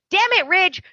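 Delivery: high, exasperated blurt; hammed-up exasperated reveal; mock outburst. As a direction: Quinn blurting the secret at Ridge, high and exasperated. Quinn reveals secret.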